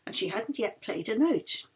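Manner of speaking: plain reading voice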